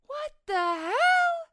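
very high, loud, drawn-out yell; mock-outraged dad shout; imitating shocked dad. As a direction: yell it slowly as a horrified dad. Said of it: "my dad go".

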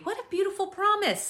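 high, delighted lift on "What a beautiful promise". Shown as a demonstration. Preacher marvelling at the promise for the congregation, delighted.

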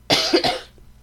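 a loud, rough cough; chesty cough. Real, not acted. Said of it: "I'm so sorry for the coughing".

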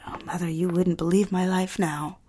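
breathy whisper to herself, wistful; whispered aside. Acted. A bride whispering to her absent mother, hushed. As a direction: confide it under your breath.

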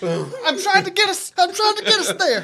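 laughing. Real, not acted. High laughing plea.

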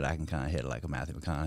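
low, quiet, quick Texas-style murmur; Matthew McConaughey. Shown as a demonstration. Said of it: "if I go into it".

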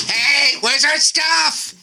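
high, slow squeaky squirrel. Retold or imitated. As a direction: draw it out like a demanding squirrel. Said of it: "They're coming back going".

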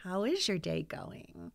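soft, sweet, gushing real estate agent; Trisha the Realtor voice. Shown as a demonstration. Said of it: "our Trisha the Realtor voice".

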